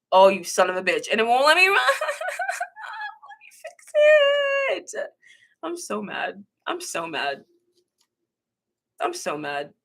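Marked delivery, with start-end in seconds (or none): 1.1-5.1 high squealed whine breaking into laughter; whining dismay. Real, not acted. Streamer cannot fix the deleted comment, whining dismay. 5.6-9.7 mad. Real, not acted.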